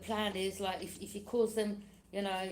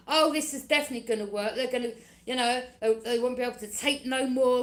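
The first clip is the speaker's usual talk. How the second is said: mock smug schemer; 3 semitones higher, 8 dB louder, faster than usual. Voicing enemies' confident scheming.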